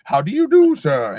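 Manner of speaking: loud, very high British "How do you do, sir?"; hammed-up posh accent. Greeting over tea and crumpets in an over-the-top posh British accent. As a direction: squeal "do" up high like a hammed-up aristocrat. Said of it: "say it with that accent".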